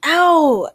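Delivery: loud, high yelp of pain. Real, not acted. Streamer aching mid-reading, pained yelp. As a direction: yelp from a sudden ache. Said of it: "y'all, I'm aching".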